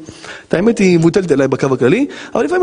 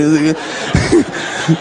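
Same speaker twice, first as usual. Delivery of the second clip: breathy laugh breaking out; can't hold back the laugh; real laugh; 3 semitones lower than usual. Real, not acted. Rabbi laughs remembering the stranger in the mikveh.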